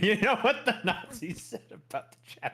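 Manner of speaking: can barely hold back the laugh while asking. Real, not acted. Laughing through a provocative joke.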